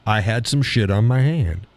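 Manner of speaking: ordinary delivery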